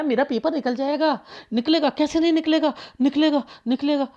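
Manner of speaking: positive self-assurance. Retold or imitated. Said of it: "positive रहा है". (ऊँची आवाज़ में ज़िद भरे भरोसे की नकल)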